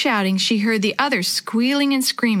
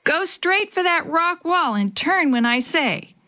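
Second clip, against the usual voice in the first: high, urgent called instruction; calling out; 7 semitones higher than usual. A narrator's reading. River guide calling to the raft steerer, calling out. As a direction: call orders across the water, without shouting. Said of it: "heard Jake calling to him".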